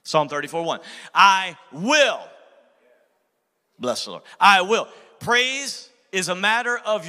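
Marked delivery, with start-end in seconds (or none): 1.1-4.2 emphasis on will; stress on I will. Shown as a demonstration. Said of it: "in the very first two words". 4.4-4.9 I will said loud and strong; loud emphatic I will; stress on I will. Shown as a demonstration. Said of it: "the very first two words".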